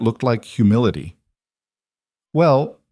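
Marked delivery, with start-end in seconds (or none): 2.3-2.8 a higher, hesitant "well"; awkward, raised opener. A narrator's reading. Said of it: "she said awkwardly".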